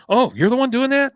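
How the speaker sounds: high, loud mock surprise; surprised. Joseph surprised at Tid Powell, loud and high. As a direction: blurt it out in disbelief. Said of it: "He goes".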